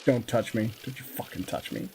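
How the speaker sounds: low disgusted snarl. Pretend. Voicing moderator recoiling.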